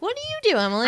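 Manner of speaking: high-pitched schoolkid question; kid asking. Retold or imitated. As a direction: imitate a child quizzing a grown-up. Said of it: "going in now and being like".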